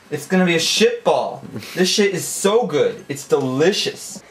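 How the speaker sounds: shouted cooking orders; hammed-up loud instructions; loud commands. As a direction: bark it across the kitchen.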